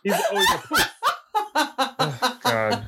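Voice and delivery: squealing high laugh. Real, not acted. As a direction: laugh through the words. Laughing at own mistake.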